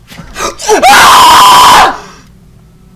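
loud, high shrieking gasp; startled scream. Real, not acted. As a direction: shriek in sudden fright. Startled by something in the game.

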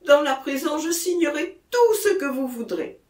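high, eager promise; desperate, heightened agreement; frantic exclamation. A narrator's reading. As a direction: promise anything to get away. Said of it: "s'écria Jacquemin".

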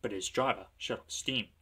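ordinary delivery

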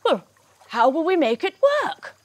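a touch of worry, acted for children; lightly voiced anxious crocodile; worried crocodile. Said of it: "But then he worries".

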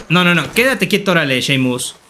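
ordinary talk

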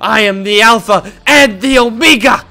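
hammed-up anime hero monologue; mock-heroic speech. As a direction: proclaim it grandly, building to the end. Parody of hero's speech.

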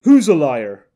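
loud challenge. Acted. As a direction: call him out across the crowd. Man challenges muttering Quiddell.

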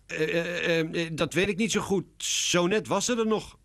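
high, hesitant stutter; stammering. A narrator's reading. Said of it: "stottert Lionel".

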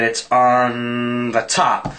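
very slow, drawn-out guess; acted deliberating. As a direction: stretch it out as if still choosing. Deliberating over his card guess.

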